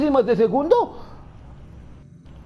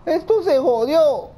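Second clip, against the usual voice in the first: higher, louder, slow despairing cry; panicked cry; 7 semitones higher, 3 dB louder, slower than usual. Real, not acted. Gamer wails at the clock, panicked cry. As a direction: wail that it's all ruined.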